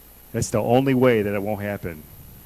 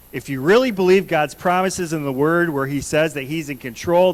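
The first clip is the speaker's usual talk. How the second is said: ordinary delivery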